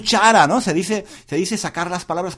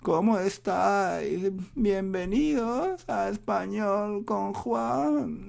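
softer, slow, mopey greeting; low sad voice; 3 semitones higher, 5 dB quieter, much slower than usual. Pretend. Host mocking a tired, sad podcast intro, slow and soft. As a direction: drag the intro out like you've lost all energy. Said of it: "no quiero que me escuchéis con la voz baja".

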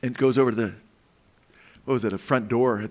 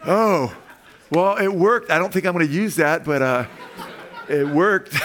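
louder, laughing as he speaks; laughing through words; 6 semitones higher, 5 dB louder than usual. Real, not acted. Grandpa quoting himself, still laughing.

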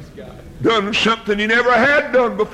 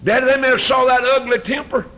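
preaching rebuke; 5 semitones higher than usual. Acted. Preacher scolding parents.

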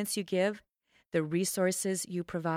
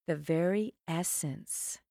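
normal talking voice

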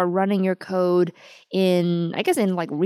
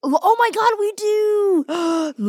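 high, drawn-out excited squeal; thrilled; 13 semitones higher, slower than usual. Real, not acted. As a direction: burst out high with real delight. Thrilled to have an episode title.

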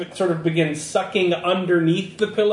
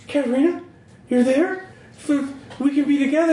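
high, hopeful calling out; pitched-up, pleading character voice; desperate plea; 9 semitones higher, faster than usual. Acted. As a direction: call to a lost love, voice pitched up. Game master voices desperate man calling Katerina.

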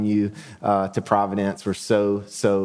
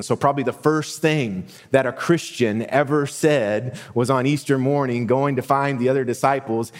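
usual speaking voice

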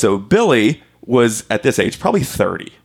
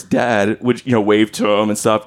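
normal talking voice

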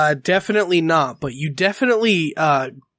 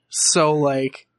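ordinary talk

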